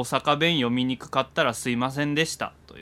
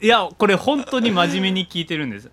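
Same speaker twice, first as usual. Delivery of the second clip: insistent; 9 semitones higher, 7 dB louder than usual. Real, not acted. Insists he is serious.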